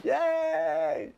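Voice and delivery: very high, drawn-out retold yay; celebratory cheer. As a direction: cheer as if jumping up and down. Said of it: "we celebrated together".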